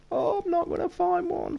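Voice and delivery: very high-pitched wail of defeat; panicked whine. Pretend. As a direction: squeal it in hammed-up despair. Panics searching library against the clock.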